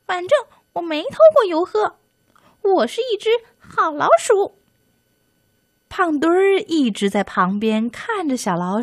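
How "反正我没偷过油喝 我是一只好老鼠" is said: high, fast defiant squeak, punching 过; indignant mouse. Acted. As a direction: insist stubbornly like a tiny mouse. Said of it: "小老鼠不服气".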